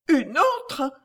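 high, dismayed lady's question; stammered shock. A narrator's reading. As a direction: echo it in shocked disbelief. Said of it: "balbutia la tante". (question aiguë, bouleversée)

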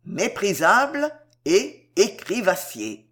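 méprisable and écrivassier each stressed hard and slowly; terrible emphasis on "méprisable" and "écrivassier"; heavy emphasis. A narrator's reading. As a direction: lean heavily on "méprisable", then "écrivassier", drawn out. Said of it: "Une terrible emphase fut mise sur les mots".

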